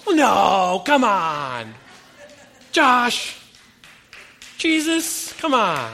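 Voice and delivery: loud, high, groaning protest; exasperated outcry. Retold or imitated. Friend protesting the preacher's Jesus answer, loud exasperated groan. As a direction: throw up your hands in mock despair.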